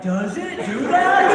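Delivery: slow, high, earnest wonder; gullible question. Retold or imitated. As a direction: naive audience member asks, slow and high. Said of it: "people that are like".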